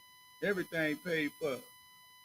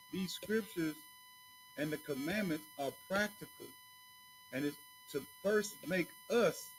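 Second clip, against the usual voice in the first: ordinary talk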